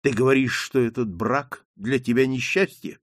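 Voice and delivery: usual speaking voice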